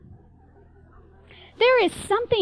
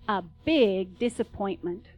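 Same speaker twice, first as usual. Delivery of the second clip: ordinary delivery, nothing marked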